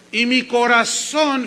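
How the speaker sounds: loud, slow and drawn out; declaimed scripture. Acted. Preacher reading David's lament to the congregation, declaimed slowly. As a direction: proclaim it, stretching the last word.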